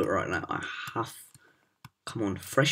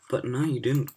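usual speaking voice

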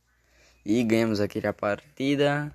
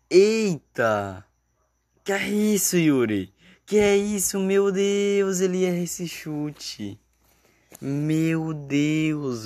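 shocked commentary; 7 semitones higher, slower than usual. Acted. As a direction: cry out at the miss. Missed shot, shocked commentary. (narrador chocado com o chute errado)

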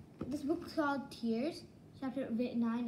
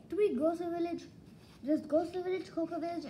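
ordinary delivery

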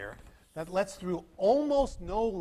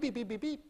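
scanner beeps; 6 semitones higher than usual. Retold or imitated. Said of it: "none of it's going beep".

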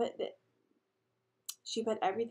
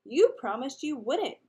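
slow, raised, loud whine; whining; 4 semitones higher, 6 dB louder than usual. A narrator's reading. Six-year-old protests Elaine's threat, whining. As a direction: whine it like a wronged little kid. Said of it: "Daniel whined".

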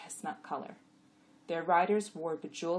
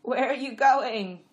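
loud, slow, raised donkey voice; questioning donkey; 7 semitones higher, 12 dB louder, slower than usual. A narrator's reading. Jack asking the jenny, loud and drawn out. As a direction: ask loudly, stretching the words. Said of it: "Costel asked Chamra".

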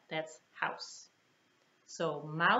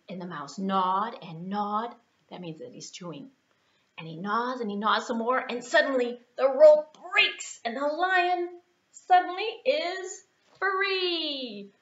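ordinary delivery, nothing marked

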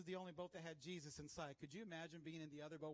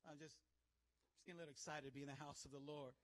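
ordinary talk, nothing marked

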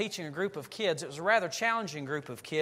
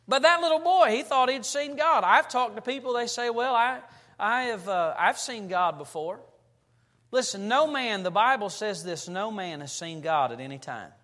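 loud, high, driving sermon voice; preaching at full force; 5 semitones higher, 5 dB louder than usual. Acted. Pastor to congregation, fervent louder preaching. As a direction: preach it out with rising fervour.

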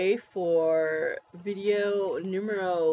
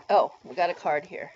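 ordinary talk, nothing marked

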